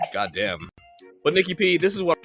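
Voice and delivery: usual speaking voice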